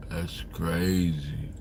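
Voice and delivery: ordinary delivery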